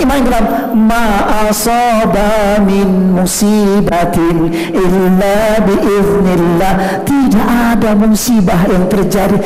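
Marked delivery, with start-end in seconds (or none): 0.7-7.1 slow, drawn-out chanted verse; slow Quranic recitation. Acted. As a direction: intone the verse slowly.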